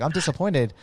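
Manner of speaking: a hint of the coach's flat, blunt verdict; lightly voiced disappointed coach; blunt disappointed mentor. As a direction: say it like a mentor who is let down, straight to the face. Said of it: "but he was like".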